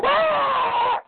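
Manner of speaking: mock a wife's shrill yelp; high-pitched emotional cry. Showing how a wife's outburst sounds to men, a squealed shout. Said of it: "that high-pitched emotional".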